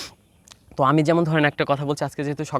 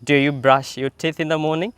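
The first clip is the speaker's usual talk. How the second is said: slow drill questions; much slower than usual. Shown as a demonstration. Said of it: "কথা বলতে হবে".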